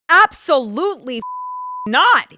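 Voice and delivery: emphatic refusal. Retold or imitated. Retelling own reply to friend.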